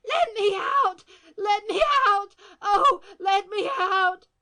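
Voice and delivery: high, loud desperate pleading; trapped woman crying out; frantic cries. A narrator's reading. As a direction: beg to be let out, terrified. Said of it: "came cries of".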